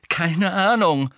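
loud, high, impatient retort; calling out. A narrator's reading. Harry counters Hermine's doubts, loudly. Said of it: "rief Harry".

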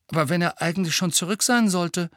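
high, anxious question; worried, frightened voice left hanging; faint, fearful. A narrator's reading. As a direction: ask it fearfully, pitch well up. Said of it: "mit schwacher, angsterfüllter Stimme".

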